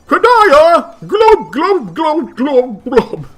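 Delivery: put-on high, loud, bubbly fish girl; excitable cuttlefish voice. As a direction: squeal it out like an overexcited sea creature. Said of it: "Help me tell without saying glub".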